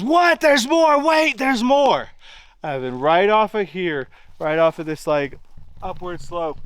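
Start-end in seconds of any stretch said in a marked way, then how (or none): 0.0-2.1 high, loud urgent call to a friend; excited shout. Retold or imitated. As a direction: yell it out like you've just spotted more. Buddy excitedly spotting more animals.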